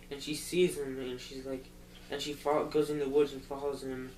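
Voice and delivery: ordinary delivery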